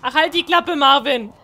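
loud, fed-up snap at the zombie; annoyed outburst. Real, not acted. Gamer snapping at the zombie Marvin, annoyed loud outburst. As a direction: shut him up with a loud yell.

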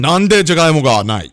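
早口の怒鳴り声; 大声で詰め寄る; shouting. Real, not acted. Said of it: "怖い、怖い、怖い". (大声で怒鳴る)

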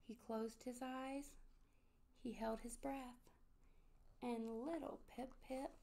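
hushed, suspenseful storybook reading; story narration. A narrator's reading. Narrator tells children of Pip-Pip nearing the water, hushed suspense.

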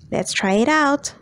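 normal talking voice

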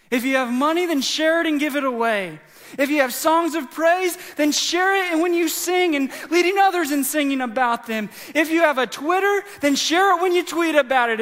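high, loud, driving sermon crescendo; full-force preaching. Real, not acted. Preacher urging the congregation to share and preach, impassioned.

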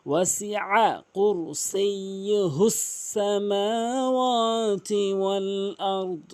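slow melodic chant, ইল্লা lifted; Quran recitation. Shown as a demonstration. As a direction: recite slowly, lifting ইল্লা.

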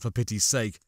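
ordinary delivery

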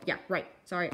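ordinary delivery

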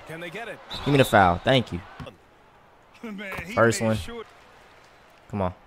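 normal talking voice

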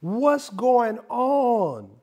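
slow, drawn-out mock sermon; hammed-up sermon, words stretched; mock preaching. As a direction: preach it like a pulpit pastor, half-joking. Comic preaching run.

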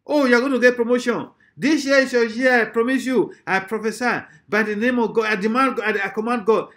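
high, loud parody of promise-making prophets; mock prophetic declaration. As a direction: proclaim it like a hyped-up prophet.

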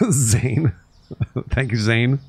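laughing through the name; laughing thanks. Real, not acted. Streamer thanks Zane for support, laughing. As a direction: thank him mid-chuckle.

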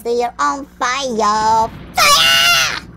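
drawn-out call exploding into a high scream; building shout. Pretend. Gamer seeing fiery eyes, building shout. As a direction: stretch it out, then shriek the last word. Yells about burning creeper eyes.

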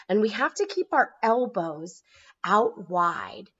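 ordinary delivery

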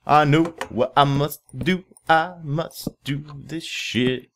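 slow, high, hammed-up declaration; slow dramatic pronouncement; mock heroic resolve. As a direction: play the fated hero for laughs.

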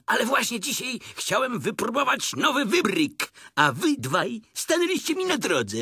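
high-pitched bouncy tiger voice; boisterous Tigger. A narrator's reading. Tigger explaining a new bounce, high and boisterous. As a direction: blurt it out high and carefree. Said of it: "bardzo rozbrykany".

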